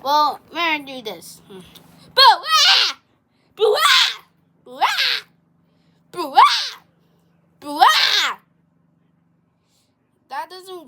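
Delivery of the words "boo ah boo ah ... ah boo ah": loud, high "boo-ah" bursts; sudden repeated scary yells; scare shouts. Acted. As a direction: jump out and shout to startle. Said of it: "your brother scared him".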